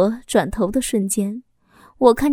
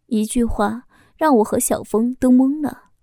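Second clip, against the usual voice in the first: ordinary delivery, nothing marked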